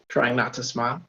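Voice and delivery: usual speaking voice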